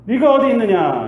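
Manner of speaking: loud, high calling voice of God; booming call of God searching for Adam. Acted. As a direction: call out for Adam across the garden.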